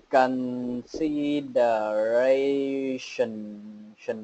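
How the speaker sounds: consideration stretched very slowly, syllable by syllable; very slow model. Shown as a demonstration. Teacher modelling consideration very slowly. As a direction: lay out consideration slowly, every sound. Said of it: "gọi đọc chuẩn sẽ là".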